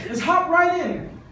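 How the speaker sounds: high, loud, laughing exhortation; preacher rallying hesitant Christians, fired up; fired-up preaching. Acted. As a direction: urge them to jump in.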